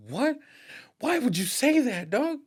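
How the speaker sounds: high-pitched, incredulous questioning; dismayed disbelief. Retold or imitated. Retold dismay at son's words.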